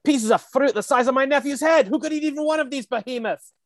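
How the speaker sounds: high-pitched, indignant Scottish commercial voice; Scottish accent. Acted. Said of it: "pretty good, uh, Scottish accent".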